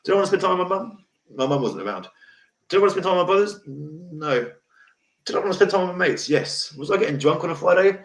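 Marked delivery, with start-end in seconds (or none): none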